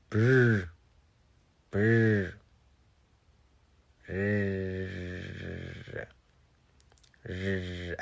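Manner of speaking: "Brr" with a clumsy attempted trill; failed R roll. Shown as a demonstration. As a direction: try and fail to trill "Brr". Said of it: "I can't roll my R's".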